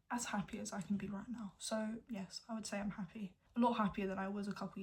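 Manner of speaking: quiet whisper. Real, not acted. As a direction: keep it hushed. Hushed, house listening.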